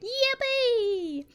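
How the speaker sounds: very high, drawn-out squeal; high-pitched little celebration; playful cheer. Pretend. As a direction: squeak out a long, high "yippee". Cheers after naming the team.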